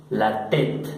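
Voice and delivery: "tête" as one syllable, "tet", no S; French pronunciation. Shown as a demonstration. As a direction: say "tête" with silent S and final E. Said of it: "Se pronuncia tête". ("tête" a la francesa, tet, sin ese)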